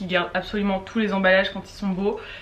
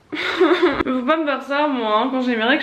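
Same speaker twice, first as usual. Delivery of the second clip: laughing complaint; 5 semitones higher, 4 dB louder than usual. Real, not acted. To viewers about the missing food, laughing whine. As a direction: laugh it off while still complaining. Laughs at her own frustration.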